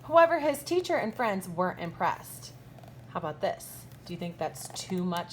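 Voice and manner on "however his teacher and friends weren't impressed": lively, bright storybook read-aloud with big swoops; reading to children. A narrator's reading. Teacher reading a picture book to her kindergartners, animated storybook voice. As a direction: read it like story time for little kids.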